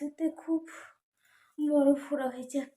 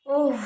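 pained cry; 11 dB louder than usual. Real, not acted. Crying out in pain.

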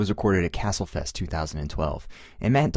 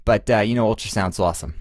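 put-on Boston accent, played for laughs; hammy, badly faked Boston accent; 2 semitones higher, 4 dB louder than usual. Shown as a demonstration. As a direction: do your best amateur Boston accent. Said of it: "in my best Boston accent".

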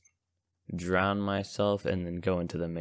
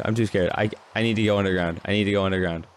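ordinary delivery

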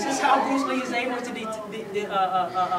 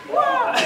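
piercing wah, much higher and louder; high kiai shout; 13 semitones higher, 5 dB louder than usual. Shown as a demonstration. Teacher showing the other fighting noise, piercing high wah. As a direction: shriek it out like Bruce Lee. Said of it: "Or he go".

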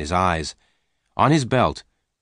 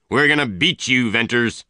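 shouting; 4 semitones higher than usual. A narrator's reading. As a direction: shout him down in rage. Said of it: "he shouted".

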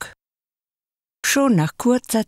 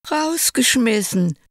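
hoch und klagend; jammernd; 8 semitones higher than usual. A narrator's reading. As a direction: weinerlich gejammert. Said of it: "jammerte der Kurze". (hoch jammernd)

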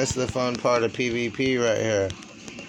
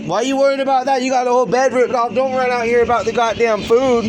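loud, high, fast angry yelling; angry shouting; 11 semitones higher, 5 dB louder, faster than usual. Real, not acted. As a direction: yell at her in a rage. Shouting back at partner.

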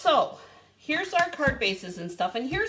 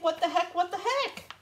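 high-pitched retold bewilderment; mock freak-out; 7 semitones higher than usual. Crafter retells hunting for stickers, mock freak-out. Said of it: "And I'm freaking out".

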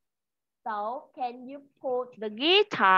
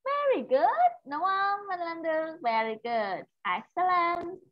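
much higher, delighted "Very good"; warm praise; 7 semitones higher, 4 dB quieter than usual. Real, not acted. Teacher praises her pupil, much higher and excited. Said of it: "praising the child".

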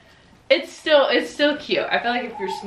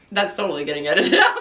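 laughing; 5 semitones higher, faster than usual. Real, not acted. Laughs after mishap on camera.

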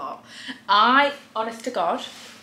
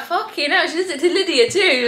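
indignant; 8 semitones higher than usual. Retold or imitated. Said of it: "And I'm like".

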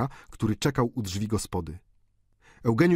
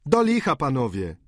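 tutor's exclamation; 5 semitones higher, 4 dB louder than usual. A narrator's reading. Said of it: "zawołał korepetytor".

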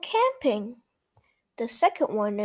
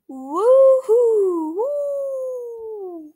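loud high howling wind; 10 semitones higher, 5 dB louder, much slower than usual. Acted. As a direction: howl like the wind outside the tent. Wind sound in story.

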